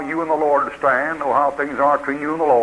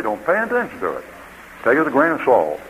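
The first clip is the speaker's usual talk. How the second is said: ordinary delivery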